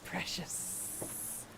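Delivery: breathless creepy whisper; whispered covetous word; whispered precious. Acted. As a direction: hiss it like a collector. Creepy NPC line.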